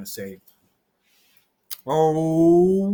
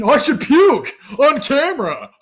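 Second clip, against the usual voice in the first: much higher and louder, cracking up mid-sentence; laughing; 10 semitones higher, 5 dB louder than usual. Real, not acted. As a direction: blurt it through laughter. Laughing at the fart dare.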